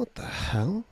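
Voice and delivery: high, baffled retort; mild mock astonishment, pitched high; retold bafflement. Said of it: "And I was like".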